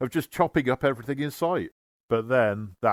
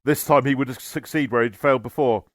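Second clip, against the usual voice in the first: ordinary delivery, nothing marked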